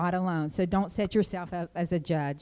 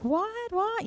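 high, baffled double what; much higher, baffled by the crash; stunned disbelief; 13 semitones higher than usual. Retold or imitated. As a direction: ask it twice in disbelief. Stunned after car hit.

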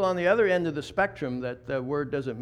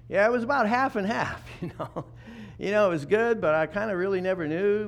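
higher, louder, laughing half-answer; voiced churchgoer shrugging it off; 5 semitones higher, 3 dB louder than usual. Preacher voicing a person with a mixed father, higher and louder with a laugh. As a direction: play someone unsure what their dad was.

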